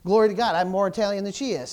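usual speaking voice

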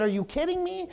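a mock stunned reaction to the news; exasperated disbelief. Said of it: "and said".

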